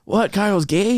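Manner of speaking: put-on playful Mexican accent for kids. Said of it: "give your nephews a Mexican accent".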